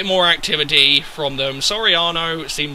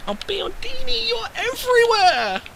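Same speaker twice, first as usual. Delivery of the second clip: soft, very high squeal of praise; high-pitched adoration; 5 dB quieter than usual. Real, not acted. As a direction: gush in a thin high voice. Gushing over his player.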